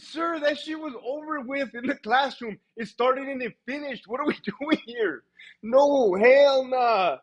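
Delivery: high-pitched mock indignation; retold exasperated objection; exasperated protest. Acting protest to boss.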